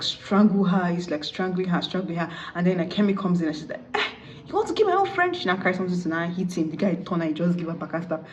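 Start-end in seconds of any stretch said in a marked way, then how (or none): 3.9-5.3 very high mock outrage; indignant confrontation. Friend confronting the groom strangling the bride, indignant. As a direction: leap in with a high, scandalised challenge.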